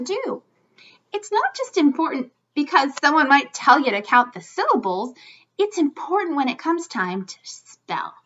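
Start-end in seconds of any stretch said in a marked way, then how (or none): none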